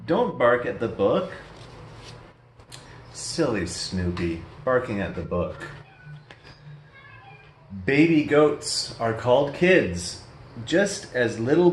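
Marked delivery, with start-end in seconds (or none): 3.1-5.7 low, soft, fond tease; soft teasing aside. Real, not acted. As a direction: murmur fondly to the toy. Fond aside to toy dog.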